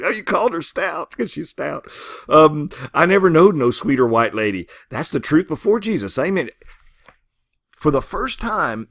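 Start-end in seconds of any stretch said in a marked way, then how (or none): 0.0-6.5 flattering farmhands. Retold or imitated. Workers flattering Ruby, voiced.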